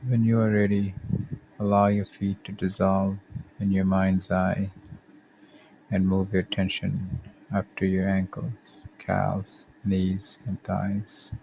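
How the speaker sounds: calm, measured body-scan guidance with long pauses; guided meditation. Acted. Podcast host guiding listeners through a body scan, calm meditation voice. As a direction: lead the listener gently through each step, unhurried.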